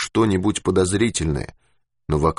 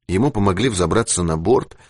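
usual speaking voice

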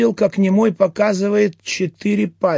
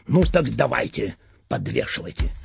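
low menacing order; 8 semitones lower, 5 dB quieter than usual. Acted.

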